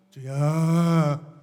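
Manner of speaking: mock sluggish, drawn-out call; mocking lazy believers' slow prayer; lazy drawl. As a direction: imitate a half-hearted prayer. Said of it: "어떤 새끼들은 기도하라고 하면".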